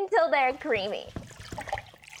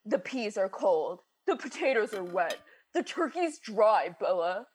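a hint of a lower, grumbling husband; mocking husband; 4 semitones lower than usual. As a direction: lightly mimic her complaining husband. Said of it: "then have the nerve to tell me".

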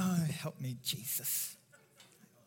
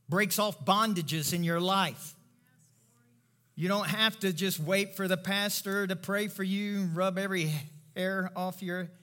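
ordinary delivery

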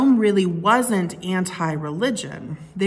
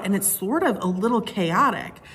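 normal talking voice

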